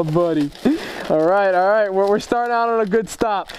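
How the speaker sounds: ordinary talk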